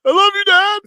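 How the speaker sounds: squeaky, loud mock sob of love; high tearful son. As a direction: gush it like an overcome son.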